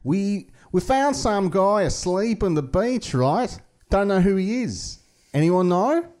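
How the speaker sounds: hammed-up casual police announcement; laid-back police appeal. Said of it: "public appeal in the paper going like".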